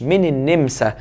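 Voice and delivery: ordinary delivery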